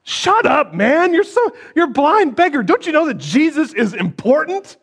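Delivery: high, scornful scolding; pious crowd snapping at the blind beggar, loud; loud rebuke. Acted. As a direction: shut the beggar down with contempt. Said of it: "Being loud".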